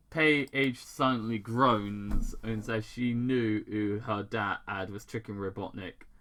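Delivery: plain reading voice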